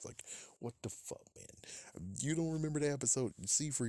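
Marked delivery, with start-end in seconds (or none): 0.0-1.5 exasperated whisper. Real, not acted. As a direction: hiss it under your breath. Exasperated aside.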